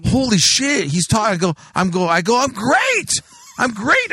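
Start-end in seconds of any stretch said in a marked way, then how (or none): 0.1-1.3 high-pitched starstruck disbelief; retold giddy astonishment; can't believe your luck, pitch up; amazed. Amazed that owner talks to him. 2.4-4.1 very high, drawn-out mock enthusiasm; hammed-up delighted squeal; overexcited reply. Starstruck reply in retold story.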